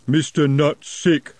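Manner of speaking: slow plodding troll. A narrator's reading. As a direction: drag it out, dim troll. Troll speaks.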